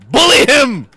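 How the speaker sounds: high, loud egging-on yell; loud shout. Real, not acted. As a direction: yell encouragement at teammates. Urging on during game.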